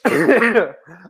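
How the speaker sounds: loud dry cough; a hard cough mid-sentence; coughing fit. Real, not acted. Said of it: "bear with me if I'm coughing".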